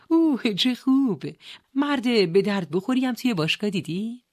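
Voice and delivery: pleased, nudging curiosity; eager delight. Acted. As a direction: react with keen, playful interest. Sister eagerly teasing brother in story.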